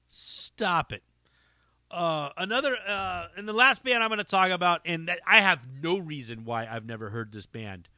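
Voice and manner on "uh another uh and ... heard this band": ordinary talk, nothing marked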